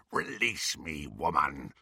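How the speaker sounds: a beast demanding release; low werewolf growl. Acted. Greyback pinned before Bellatrix, low growl.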